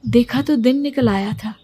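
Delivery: ordinary delivery, nothing marked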